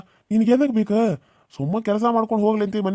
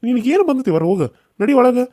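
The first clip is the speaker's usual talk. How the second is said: higher and louder, ordering her inside; angry order; 5 semitones higher, 4 dB louder than usual. Acted. Uncle angrily sends the quarrelling woman indoors, loud order. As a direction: bark the command to get inside.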